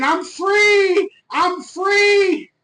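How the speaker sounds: high, drawn-out celebratory shout; mock scream. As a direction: scream it like you've just been released.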